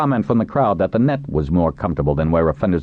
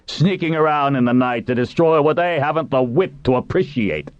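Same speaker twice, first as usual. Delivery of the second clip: high, indignant scorn; disgusted tirade; 10 semitones higher than usual. A narrator's reading. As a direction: denounce them with disgust to the crowd. Captain's disgusted tirade to crowd.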